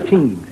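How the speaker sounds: "Teams" for them, hard t; th turned to a t; Irish "them" as "Teams". Shown as a demonstration. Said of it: "and them".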